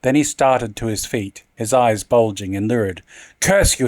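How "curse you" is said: shriek. Acted. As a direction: shriek it out in fury. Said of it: "he shrieked".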